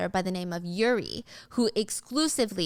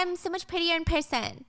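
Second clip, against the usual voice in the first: flirty call; 9 semitones higher than usual. Acted. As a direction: coax a shy client out. Angela flirting with hidden client.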